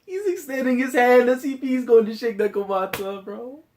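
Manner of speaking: high-pitched, emotional exclamation; overwhelmed disbelief. Real, not acted. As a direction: voice way up high, sinking then lifting at the end. Moved reaction to the handshake.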